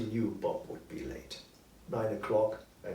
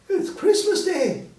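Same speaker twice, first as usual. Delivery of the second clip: put-on boy's voice; 10 dB louder than usual. Boy answers Scrooge.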